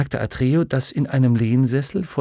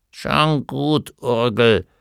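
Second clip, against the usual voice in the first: grumbling; much slower than usual. A narrator's reading. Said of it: "brummte das Männchen".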